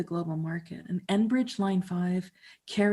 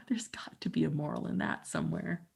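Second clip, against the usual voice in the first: soft breathy laugh-whisper; 7 dB quieter than usual. Real, not acted. After the phone alarm reveal, quiet amused whisper. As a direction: chuckle it under your breath.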